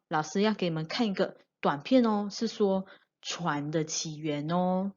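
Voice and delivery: ordinary talk, nothing marked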